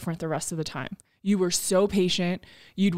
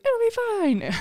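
breezy mock reassurance; slower than usual. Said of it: "we're like".

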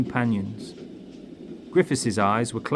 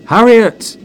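much higher, loud call of a name; loud desperate call; 12 semitones higher, 12 dB louder than usual. Acted. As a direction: call across the room to his lost wife. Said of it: "Griffiths called out".

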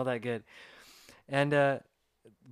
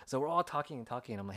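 ordinary delivery

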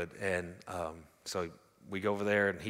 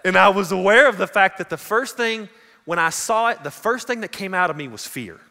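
loud, raised-pitch preacher's confession; full-force preaching; 10 semitones higher, 13 dB louder than usual. Real, not acted. Preacher confessing fear to the congregation, full-force preaching. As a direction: belt it out to the congregation, high and loud.

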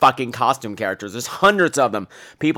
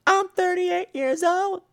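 very high, breathy falsetto giggle; slower than usual. Retold or imitated. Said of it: "sounds like [name]".

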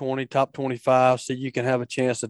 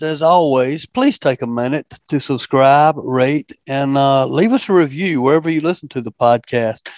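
upbeat announcer; 7 dB louder than usual. Acted. Host's closing sign-off.

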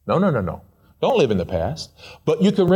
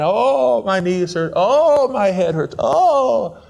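mock whining; 5 semitones higher, slower than usual. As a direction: whine like an aching complainer. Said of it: "I want to whine and whine and dine".

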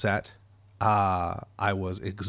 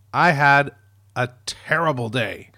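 ordinary delivery

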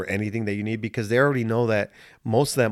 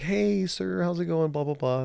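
higher, chirpy lady voice; sing-song greeting like a cheery staff lady; friendly nurse; 7 semitones higher, 4 dB quieter than usual. Retold or imitated. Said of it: "And she's like".

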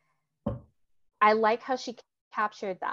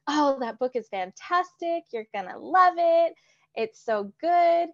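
higher and louder mock gush, slowing on so good; gushing family member; 6 semitones higher, 5 dB louder than usual. Mimicking family who praised the book with mock gushing excitement. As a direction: imitate relatives raving about a hyped book.

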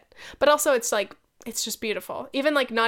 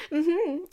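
high, intentional put-on chuckle into mm-hmm; character laugh; 8 semitones higher than usual. Shown as a demonstration. Podcaster defends laugh as acting, put-on character laugh. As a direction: demo a knowing character's fake laugh. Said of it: "doing a character in my head that's like this".

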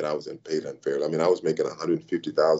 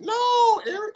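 high, louder nasal mimic; John Shaw's voice; 3 dB louder, slower than usual. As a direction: snap back in the boss's nasal whine. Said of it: "he has a nasally voice".

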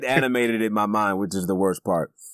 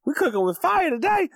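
hyped-up squeal, much higher; excited high voice. Real, not acted. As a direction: hype the co-host up, way high. Excited hype about co-host.

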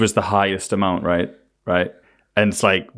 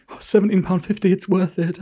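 put-on eager kid, higher; 9 semitones higher than usual.

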